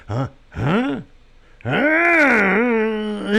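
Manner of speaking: high, bewildered repeated huh; a befuddled old champ who can't follow; confused old man. Retold or imitated. Confused old boxer reacting.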